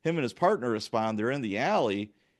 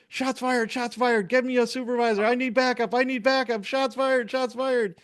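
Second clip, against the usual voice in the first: high, urgent cop calling for backup over the radio; desperate officer shouting shots fired on the radio; panicked radio call; 13 semitones higher, 4 dB louder than usual. Retold or imitated. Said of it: "we both hear over the air".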